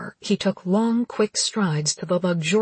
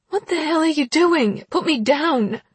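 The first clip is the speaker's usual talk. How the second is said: outraged shout; 10 semitones higher, 5 dB louder than usual. Acted. Heroine thrown over shoulder.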